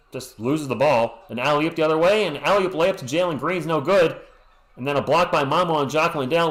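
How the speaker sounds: normal talking voice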